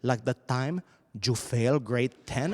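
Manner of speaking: put-on low, accusing Latin-accented mother; indignant Latin-accented scolding. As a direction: scold your kid in a thick Spanish accent.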